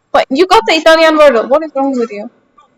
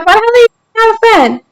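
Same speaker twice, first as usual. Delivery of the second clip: exasperated scold; 8 semitones higher, 4 dB louder than usual. Real, not acted. Scolding friend leaving bed.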